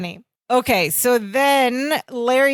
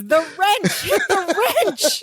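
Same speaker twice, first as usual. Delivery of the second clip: high, drawn-out frustration through laughter; laughing, high-pitched mock outburst; exasperated mock yell; 7 semitones higher, slower than usual. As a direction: imitate yelling a correction, half laughing. Acted exasperated yelling, amused.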